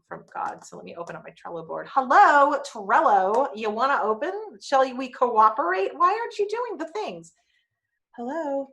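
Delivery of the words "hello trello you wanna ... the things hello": 1 coaxing the app. Acted.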